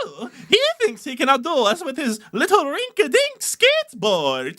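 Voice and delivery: high, goofy clown voice; hammy circus clown taunt; silly clown. Acted. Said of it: "Why did they suddenly become Euro?".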